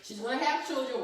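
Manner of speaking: ordinary delivery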